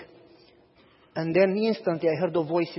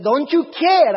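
higher, louder hurt protest; indignant protest; 8 semitones higher, 6 dB louder, slower than usual. Retold or imitated. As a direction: fling it back at the pastor. Lady protests to pastor.

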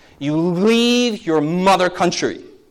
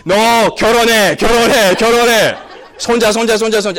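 mock nagging parent; 5 semitones higher, 4 dB louder, much faster than usual. Immigrant parent nagging at home.

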